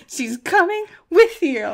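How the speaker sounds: high-pitched exasperated insistence; losing her mind. Retold or imitated. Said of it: "I absolutely was losing my mind".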